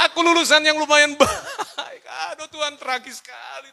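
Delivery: hammed-up sobbing complaint; mock crying. Graduate turned rider lamenting to God, mock wailing. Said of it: "saya nangis".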